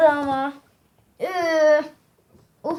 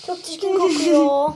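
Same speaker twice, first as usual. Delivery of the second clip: gagging. Real, not acted. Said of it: "Ay, kusacağım".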